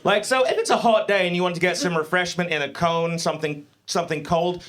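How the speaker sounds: ordinary delivery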